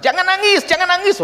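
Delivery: imitating a high, loud telling-off; high-pitched loud order, mimicking a village scolder; scolding. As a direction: act out someone scolding a mourner. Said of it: "Masa orang mau nangis dimarahi".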